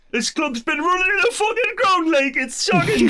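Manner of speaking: high, loud imitation of a Geordie fan moaning; Geordie accent. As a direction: do a Sunderland local complaining in a thick Geordie accent. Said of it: "I can't do a Geordie accent".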